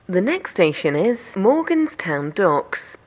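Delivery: ordinary delivery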